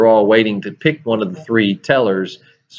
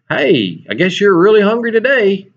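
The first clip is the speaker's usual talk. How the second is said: higher, teasing sarcastic jab; sarcastic dig at a queue jumper; smart-aleck jab; 9 semitones higher than usual. Retold or imitated. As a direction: needle the line-cutter with mock sympathy. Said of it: "say something smart aleck".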